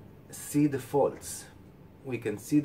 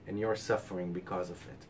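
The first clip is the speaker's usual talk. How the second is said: usual speaking voice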